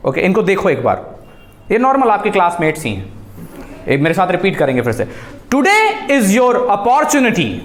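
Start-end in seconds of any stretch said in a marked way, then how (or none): 5.5-7.5 TODAY high and drawn out; motivating delivery. Shown as a demonstration. Coach leading a repeat-after-me drill, motivating and deliberate. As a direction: say "Today is your opportunity" slowly, to inspire. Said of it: "you should feel कि आप इनको motivate कर पा रहे हो".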